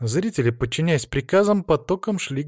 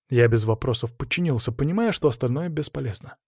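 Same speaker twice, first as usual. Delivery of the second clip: ordinary delivery, nothing marked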